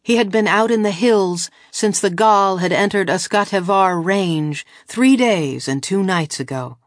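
usual speaking voice

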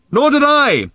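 very high, loud yell; shouting back. A narrator's reading. Arthur agrees with Ford, shouting back. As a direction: holler agreement over the din. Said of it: "shouted Arthur back".

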